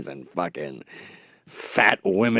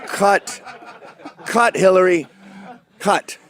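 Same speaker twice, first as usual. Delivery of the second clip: high, raised calls to stop; mock director's repeated shout; director calling cut; 7 semitones higher than usual. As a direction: call a halt over the performance. Director stopping the parodied candidate.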